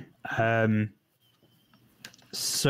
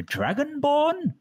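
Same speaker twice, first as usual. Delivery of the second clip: much higher, puzzled; squeaky surprised question; slower than usual. Acted. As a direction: pitch it up in disbelief. NPC questions an odd stranger.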